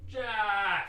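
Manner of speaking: high, stretched-out shout; mock call for the manager. Clerk calling his manager over, imitated shout. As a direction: holler as if with head thrown back. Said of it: "Just throws his head back".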